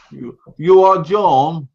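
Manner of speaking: slow, lightly Russian-accented woman; attempted Russian accent. Shown as a demonstration. Showing John how Russian women talk, slow attempted Russian accent. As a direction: have a go at a Russian accent, drawn out. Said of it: "they have that kind of like".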